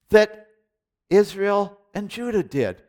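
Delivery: usual speaking voice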